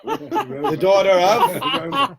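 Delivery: drawn out, breaking into laughter; laughing. Real, not acted. Rabbi laughing at the dog's lineage question, slow and amused. Teller laughs retelling the punchline.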